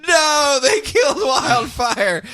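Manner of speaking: high shouted cry, slow; dramatic yell. Retold or imitated. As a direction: yell it in mock horror. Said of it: "The same characters yell".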